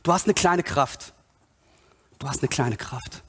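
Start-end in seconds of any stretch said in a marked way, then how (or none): 2.2-3.2 low, hushed scripture quote; quiet, pitched-down echo; soft repeat. Acted. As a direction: let it sink in softly. Softer repeat of the quotation.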